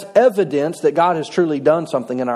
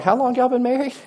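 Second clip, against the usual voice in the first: higher, lightly voiced pastor asking; surprised pastor; 6 semitones higher than usual. Pastor asks the old couple, mildly incredulous and higher. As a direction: play the puzzled pastor, pitched up. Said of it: "and he's like".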